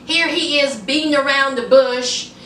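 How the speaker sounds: ordinary talk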